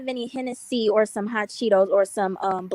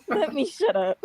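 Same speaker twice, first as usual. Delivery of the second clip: laughing; 5 semitones higher than usual. Real, not acted. Repeats it again, still laughing.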